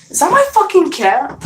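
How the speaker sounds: sudden high yelp of surprise; startled outburst. Real, not acted. Vlogger startled by the cat mid-story, high surprised yelp. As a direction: jump at the noise, voice shooting up.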